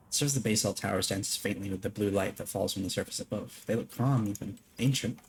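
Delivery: soft, steady narration of the lore; quiet lore-reading voice; reading game text. Acted. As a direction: read it like a hushed story narrator. Reads game text aloud.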